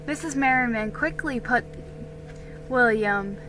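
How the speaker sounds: plain reading voice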